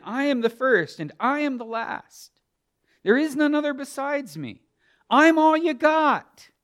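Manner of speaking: high, loud proclamation as God; emphatic divine declaration. Acted. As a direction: assert sole claim at full preaching force. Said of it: "God is emphatically putting himself out there".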